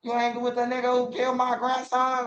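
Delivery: high, wounded granny. Retold or imitated. Granny asks grandson about the killer, high and hurt. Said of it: "My granny don't even gang bang".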